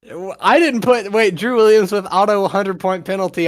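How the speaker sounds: ordinary talk, nothing marked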